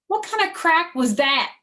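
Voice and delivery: high, loud indignant snap; high, loud suspicious retort; offended May. Acted. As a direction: take offence at the greeting. May frowns.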